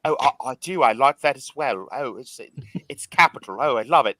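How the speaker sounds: lightly hammed-up British flirt; playing a posh Brit, half-serious; mock British accent. TikTok Brit answers, mock British accent. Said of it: "What fucking accent was that".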